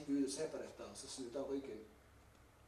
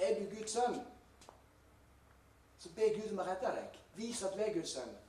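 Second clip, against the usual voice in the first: loud, higher-pitched jeering challenge; mocking taunt; 7 semitones higher, 7 dB louder than usual. Robber beside Jesus scorns him, loud mocking challenge. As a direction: throw it out as a loud, acted taunt. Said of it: "begynner å bare håne Jesus".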